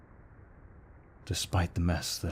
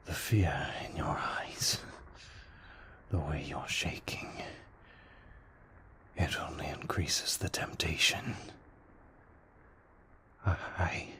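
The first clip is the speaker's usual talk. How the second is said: breathy, halting, half-whispered confession; struggling with temptation; 5 dB quieter, slower than usual. Acted. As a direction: fight the craving, words slowly slipping out in whispery breaths. Vampire losing control to bloodlust.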